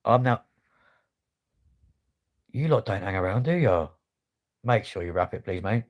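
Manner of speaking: ordinary talk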